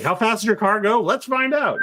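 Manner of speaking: rising, eager dare; excited. Retold or imitated. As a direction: hype yourself up, pitch climbing. Reckless partier egging himself on.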